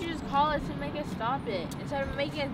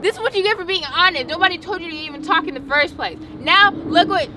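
loud, high-pitched tirade at a sibling; exasperated telling-off; angry blaming; 5 semitones higher, 6 dB louder than usual. Acted. Sibling scolds the honest one.

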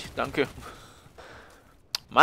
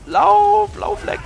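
being chased; 12 dB louder, much slower than usual. Real, not acted. Fleeing enemies in game.

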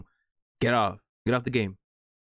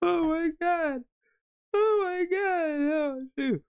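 very high, drawn-out tearful squeal; overcome with laughter; slower than usual. Real, not acted. Viewer trying to recover from a video, squealing tearfully. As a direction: wail it helplessly through a laughing fit.

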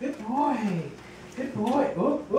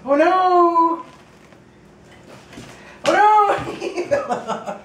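surprised; 6 semitones higher, 5 dB louder, much slower than usual. Real, not acted. Dog falls off ball.

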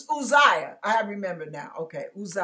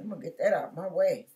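quiet, quick scheming aside to himself; 7 semitones lower, 5 dB quieter, much faster than usual. Retold or imitated. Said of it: "He said".

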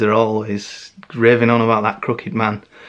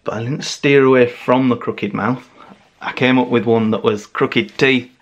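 ordinary delivery

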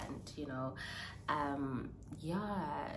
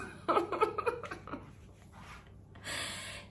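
high breathy giddy laugh. Real, not acted. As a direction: laugh out the excitement. Laughing after news.

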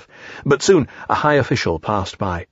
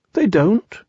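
surprised Pooh; 10 semitones higher than usual. Acted.

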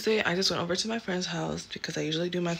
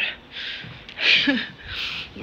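breathy laugh; 6 semitones higher than usual. Real, not acted. Laughs at own joke.

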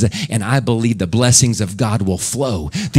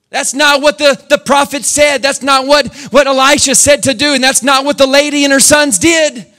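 high, loud preacher's cry; shouting sermon pitched high; full-force preaching; 13 semitones higher, 4 dB louder than usual. Acted. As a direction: preach it out to the rafters.

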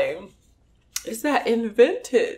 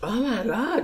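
ordinary talk, nothing marked

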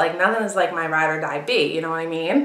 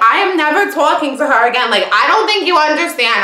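emphatic, raised and louder declaration; retold angry vow; 7 semitones higher, 5 dB louder than usual. Retelling to the other girl the end of friendship, adamant. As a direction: insist hotly, high and forceful.

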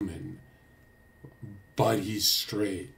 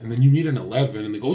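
ordinary talk, nothing marked

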